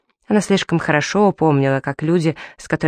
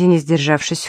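ordinary delivery

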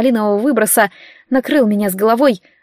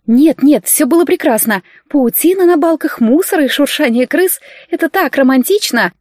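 sarcastic gushing; 6 semitones higher, 3 dB louder than usual. A narrator's reading. Sarcastic praise of dirty attic.

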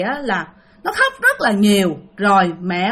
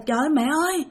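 higher, fast, alarmed; alarmed cry; 6 semitones higher, much faster than usual. Retold or imitated. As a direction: blurt it out in a rush of fright. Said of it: "thì nó la lên".

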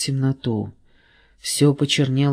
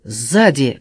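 louder warning call; shout; 4 dB louder, slower than usual. A narrator's reading. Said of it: "крикнул Флеш".

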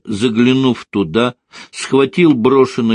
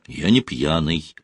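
lower, mildly pitiful protest; understated plaintive reply; 5 semitones lower, 4 dB quieter than usual. A narrator's reading. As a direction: plead a little pitifully, voice dropped. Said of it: "жалостно отозвался он".

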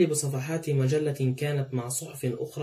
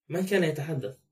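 ordinary delivery

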